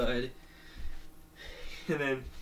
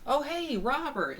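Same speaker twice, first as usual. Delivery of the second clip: high, loud cartoon hello; excited greeting; 13 semitones higher, 4 dB louder than usual. Diamond spotting Robert at the mall, high excited greeting. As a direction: call out brightly to a friend.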